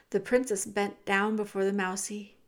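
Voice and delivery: ordinary delivery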